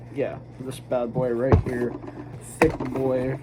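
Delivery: ordinary talk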